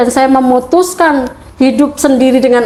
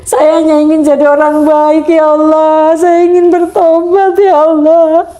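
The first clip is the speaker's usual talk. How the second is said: tearful prayer; 3 semitones higher than usual. Retold or imitated. Alone under the sky, begging Allah, tearful and raised. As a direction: cry out to God, voice breaking on the last line. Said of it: "Saya pun bicara sendiri".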